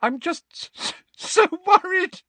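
high, breaking voice through tears; Molly confessing her fear, crying; tearful. A narrator's reading. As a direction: let the tears spill into the words. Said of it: "tears spilling out of her eyes".

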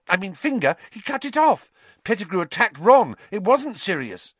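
high, hurried excited pleading; bursting out. Acted. Students bursting out to the headmaster, high and urgent. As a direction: pour it all out at once. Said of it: "burst into speech".

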